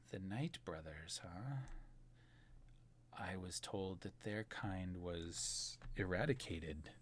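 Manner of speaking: low, hushed, slow villain; sinister woman. Acted. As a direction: speak softly with long, measured pauses. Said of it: "the woman kind of looks to you and she says".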